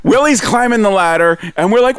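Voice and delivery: usual speaking voice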